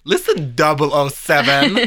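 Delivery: loud, slow address to an agent; playful spy-film challenge; mock spy interrogation. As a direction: confront the secret agent, hammed up. Playfully confronting a supposed spy.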